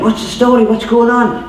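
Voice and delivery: high-pitched, eager questions; curious blind beggar. Acted. Blind beggar asking the crowd what is happening, high and curious. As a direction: call out wanting to know.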